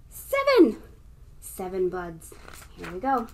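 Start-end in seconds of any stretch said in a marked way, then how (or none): 0.1-0.8 excited. Acted. Counting climax.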